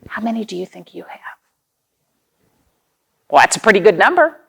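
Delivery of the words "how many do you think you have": fast, playful whispered question. Real, not acted. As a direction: whisper it like a shared secret. Teacher whispers question to children.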